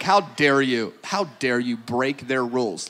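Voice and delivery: low, mock-stern reproach; mock indignation. Imagined listener scolds him for breaking stadium rules, mock indignation. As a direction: play a disapproving rule-follower, voice dropped low. Voices disapproving rule-followers in the crowd.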